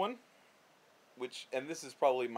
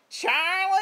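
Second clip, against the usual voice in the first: put-on much higher, loud shrieking call of an old lady; grandma calling Charlie; 11 dB louder than usual. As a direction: holler for Charlie across the yard like grandma. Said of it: "what she actually sounds like".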